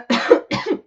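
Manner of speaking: sudden choking cough; coughing fit. Real, not acted. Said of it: "pardon, je m'étouffe en plus".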